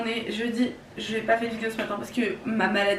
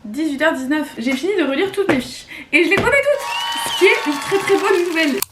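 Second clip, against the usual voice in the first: excited; 7 semitones higher, 6 dB louder than usual. Real, not acted. Excited progress update.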